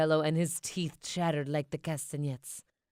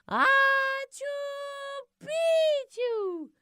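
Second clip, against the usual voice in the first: high squeaky sneeze blending into a word; sneezed password; 4 dB louder, much slower than usual. Acted. El Skipito sneezing the secret password into the chihuahua's ear, high sneeze. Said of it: "half sneezed, half spoke".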